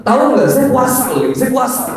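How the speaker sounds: very high, squawking mock outburst; hammed-up strident complaint; worked-up protest. Shown as a demonstration. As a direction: blurt it indignantly, far up in pitch. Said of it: "Nggak usah".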